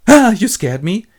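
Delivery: high, loud startled gasp. Acted. Wife startled as her husband enters, acted fright. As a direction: jump in fright, hammed up.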